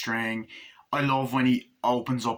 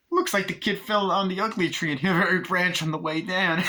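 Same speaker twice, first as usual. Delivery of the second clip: high, mocking Chucky voice; Chucky's voice; 7 semitones higher than usual. Chucky insulting Glenn, high and mocking. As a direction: jeer at the kid. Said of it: "he's like".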